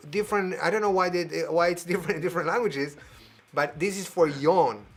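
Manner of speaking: ordinary talk, nothing marked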